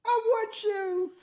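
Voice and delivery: slow, high, breathy-free seductive falsetto; high sultry falsetto. Acted. Voicing a seductive character.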